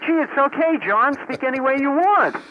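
high, breezy imitation of approval; mock permissive quote. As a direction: playfully imitate someone giving the go-ahead. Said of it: "now you're telling me".

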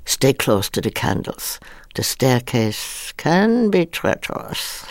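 slow, rising on can, drawn out; director's way of saying it; Mel's line reading. Retold or imitated. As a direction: stretch the warning slowly. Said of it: "it was a line reading".

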